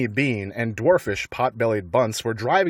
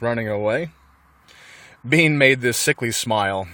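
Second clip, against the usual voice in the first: plain reading voice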